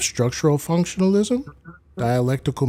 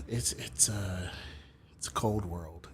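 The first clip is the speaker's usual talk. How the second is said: whisper; 6 semitones lower, 16 dB quieter than usual. Real, not acted. Alone, muttering after a sigh.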